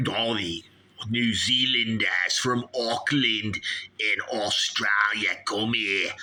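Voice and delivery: broad mock-Aussie rant; Australian accent. Host rants about letting foreigners in, mock Australian accent. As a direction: rant in exaggerated Australian vowels. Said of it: "You're bloody talking like me".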